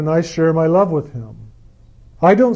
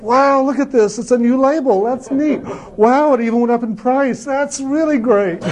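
much higher, hammed-up wonder at a can label; higher, playful amazement at a can of cream corn; mock enthusiasm; 7 semitones higher than usual. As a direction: gush over nothing in jest. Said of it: "you can be enthusiastic about picking up a can of cream corn".

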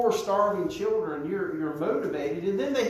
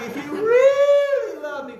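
high, loud, drawn-out mock whine; hammed-up pleading with REALLY stretched out; mock guilt-trip; 8 dB louder than usual. Preacher mimicking a misreading of Jesus as guilting, high whiny mock plea. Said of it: "trying to do guilt".

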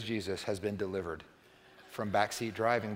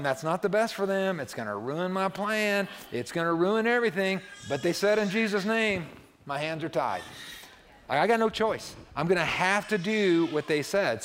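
louder, higher, mock-frustrated God with tied hands; God's exasperated voice; 6 semitones higher, 6 dB louder than usual. God grumbling about a prayer he must grant, louder and higher. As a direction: play God throwing up his hands. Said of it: "God is up there going".

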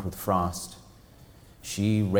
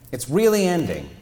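dawning shock; 7 semitones higher, 6 dB louder than usual. Acted. Janet realizing, pitched up.

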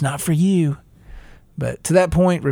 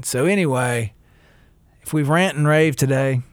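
usual speaking voice

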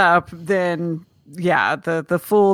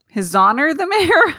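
"His Honor the Mayor?" read again, laughing and higher; 7 semitones higher than usual. Real, not acted. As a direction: repeat the name through a laugh. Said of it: "Yeah, you, that was it".

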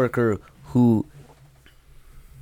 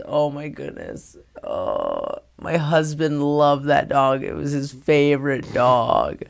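higher, lightly voiced weepy wife; mock tearful lady; 3 semitones higher than usual. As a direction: imitate a distressed wife lamenting. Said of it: "the lady just starts crying".

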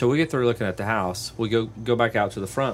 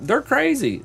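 exasperated; 3 dB louder than usual. Retold or imitated. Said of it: "I was like".

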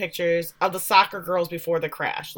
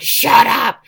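loud, higher retold outburst; mock angry shout; 4 semitones higher, 10 dB louder than usual. Jackie silences Tyson in the woods quarrel, acted angry shout.

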